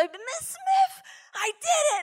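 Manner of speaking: high, thrilled announcement; excited child. Retold or imitated. Boy telling his teacher he did it, high and thrilled. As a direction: burst out with pride like a kid.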